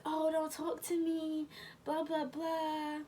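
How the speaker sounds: high, drawn-out mocking imitation of a sulk; mock sulking boyfriend. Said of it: "He's mad".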